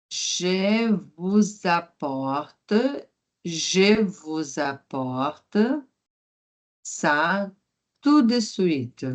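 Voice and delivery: je vous apporte said very slowly in chunks; slow teacher repetition; slow model line. Shown as a demonstration. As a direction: break it into slow pieces for learners.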